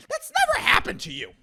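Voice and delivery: high-pitched indignant denial; incredulous, high-voiced rebuttal; indignant squawk. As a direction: shoot up high in disbelief. Indignant rebuttal of his mock scenario.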